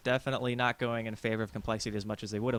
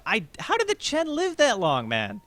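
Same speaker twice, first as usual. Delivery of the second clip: shocked, very high pitched question; can't believe he survived; disbelief; 11 semitones higher, 6 dB louder, slower than usual. Real, not acted.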